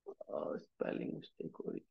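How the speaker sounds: very soft, whispered self-correction; muttered aside. Real, not acted. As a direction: whisper it to yourself while fixing a typo. Aside to self about typo. (खुद से फुसफुसाते हुए)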